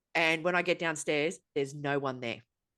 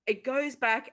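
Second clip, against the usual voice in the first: ordinary talk, nothing marked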